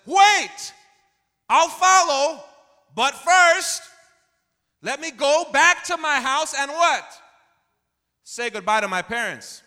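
Elisha cries out high and loud, slow on the last words; shouted plea. Acted. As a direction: call out desperately, high and strong. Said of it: "and Elisha says".

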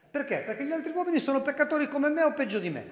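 high, loud, rapid-fire self-defence; self-justifying mafioso. Acted. Preacher voicing mafiosi justifying themselves to interviewers, loud self-justification.

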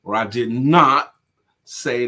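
"not" punched loud and slow; stress on NOT. Shown as a demonstration. Demonstrating emphatic denial to students, hitting "not" hard. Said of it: "that's an emphatic denial".

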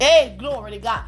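ordinary delivery

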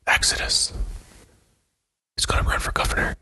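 whispered campaign reveal; hushed, unhurried mock announcement; mock secretive whisper. Mock campaign reveal after throat clearing.

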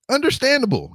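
high, quick relieved reply; eager agreement. Retold or imitated. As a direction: jump at the way out.

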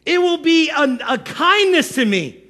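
high, pleading mock martyr; Moses as victim. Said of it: "this guy's serious".

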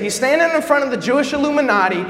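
normal talking voice

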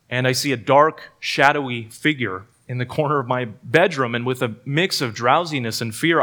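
normal talking voice

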